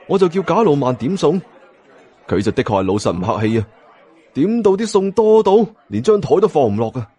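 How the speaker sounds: ordinary delivery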